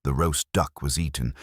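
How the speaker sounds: ordinary delivery, nothing marked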